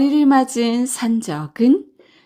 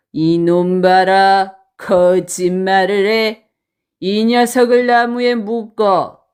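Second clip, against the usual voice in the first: menacing bandit; 3 semitones lower, 3 dB louder than usual. Acted. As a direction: draw out the threat like a bandit boss. Bandit orders captive tied up.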